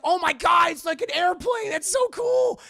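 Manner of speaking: high, loud fanboy gushing; mock excited teen. Imitating Peter seeing a private jet, thrilled shouting. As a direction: shout it like an overexcited kid.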